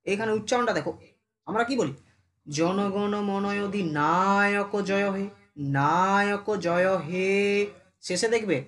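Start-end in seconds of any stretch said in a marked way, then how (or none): 2.5-5.2 "না" in "অধিনায়ক" held long, in a slow chant; drawn-out syllables. Shown as a demonstration. Teacher showing how the anthem is said, "না" and "হে" drawn out. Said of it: "হেটাকে আমরা টান দিই". 5.8-7.3 slow chant with "না" of "Naik" held long; drawn-out syllables. Shown as a demonstration. Teacher repeating the anthem's end, "না" and "হে" drawn out. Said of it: "হেটাকে আমরা টান দিই".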